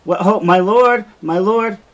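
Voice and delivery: a servant calls urgently to her lord through the door; calling through the door. Acted. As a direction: call out to be heard through a door. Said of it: "and she calls".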